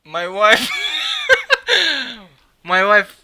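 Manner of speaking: loud laughing shout; shouting for his wife, laughing; calling out to wife. Real, not acted. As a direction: yell for the wife through laughter. Shouting to wife about gift.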